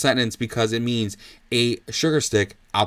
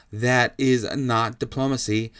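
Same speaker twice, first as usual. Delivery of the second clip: ordinary talk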